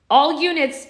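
loud barked order; shouted order. Acted. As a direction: shout it to the whole squad. Soldier calls out again, louder.

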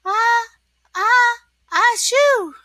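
high, loud build-up to a sneeze; ah-ah-ah exploding into achoo; hammed-up storybook sneeze; acted sneeze. A narrator's reading. Sick SpongeBob sneezes.

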